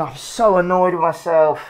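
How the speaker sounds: normal talking voice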